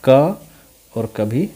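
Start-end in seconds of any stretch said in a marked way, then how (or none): 0.1-0.1 "क" as a standalone "kuh"; letter's sound. Shown as a demonstration. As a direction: isolate "क", the hard C of "cat". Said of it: "आता है कभी". ("क" का अलग, स्पष्ट उच्चारण)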